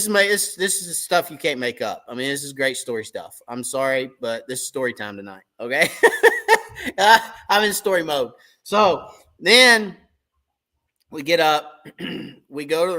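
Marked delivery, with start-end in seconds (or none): 5.9-6.9 a high, loud burst of laughter. Real, not acted. Laughs at own story.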